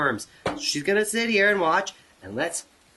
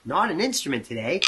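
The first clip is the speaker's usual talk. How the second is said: ordinary talk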